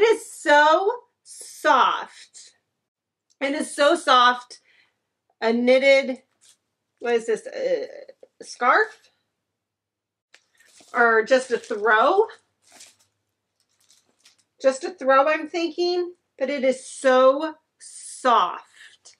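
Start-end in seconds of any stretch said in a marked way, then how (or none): none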